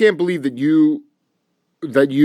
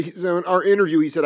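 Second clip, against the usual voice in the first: ordinary delivery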